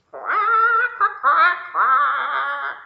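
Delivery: high loud quacks; duck quacking. Acted. The duck quacks his lullaby to Lily, high and loud.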